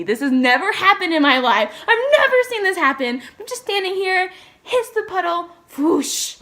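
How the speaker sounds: shocked outrage. Real, not acted. Retelling car splashing her.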